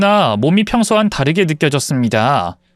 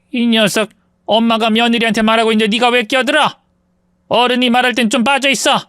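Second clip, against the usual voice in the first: mother-in-law scolding her son, pitched much higher; raised voice; 8 semitones higher than usual. Acted. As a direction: snap at the son with a high, sharp scold. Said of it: "언성을 높이셨습니다". (아들을 나무라며 언성을 높이는 시어머니)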